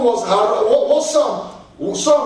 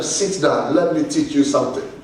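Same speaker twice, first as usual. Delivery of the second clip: much lower, composed reply; lightly voiced calm teacher; 9 semitones lower than usual. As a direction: lightly play the master settling his pupils. Jesus answers his complaining disciples.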